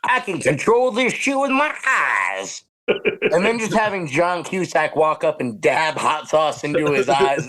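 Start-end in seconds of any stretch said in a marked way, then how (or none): 0.0-2.6 mock country accent, pitched high and drawn out. Host imitating Ben Kingsley's wheelchair line, country accent. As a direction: declare it slowly in a high country twang. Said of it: "Country accent".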